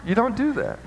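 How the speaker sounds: laughing as you repeat the warning; amused second warning. Real, not acted. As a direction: say it again through a laugh, high. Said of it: "repeated, laughing".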